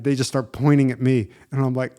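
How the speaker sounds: normal talking voice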